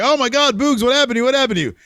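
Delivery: high, loud, fake-worried cry; [name] pretending to worry about his fallen rival; mock concern. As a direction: ham up the shock so no one believes it. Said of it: "kind of mockingly".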